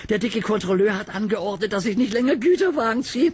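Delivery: high, loud smug engine voice; proud engine James. Acted. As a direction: show off the important errand. Narrator voices James boasting.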